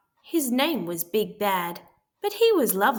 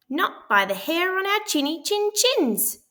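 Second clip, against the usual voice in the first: very high squeaky pig voice; squealing little pig; 9 semitones higher, 4 dB louder than usual. A narrator's reading. Pig refuses the wolf at the door, high squeal. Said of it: "squealed the two little pigs".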